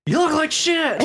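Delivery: squealing mock insult; very high squeal. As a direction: shriek it up high and teasing. Echoing the quote even higher.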